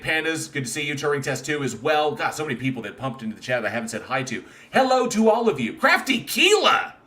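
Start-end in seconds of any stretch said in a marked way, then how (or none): 4.7-7.0 much higher, louder hello, name stretched; excited greeting. Real, not acted. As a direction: hail the newcomer with delight. Greeting chat arrivals.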